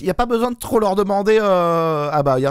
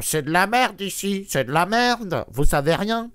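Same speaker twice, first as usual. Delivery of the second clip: mocking leftist; 2 semitones higher, slower than usual. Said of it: "ils me font".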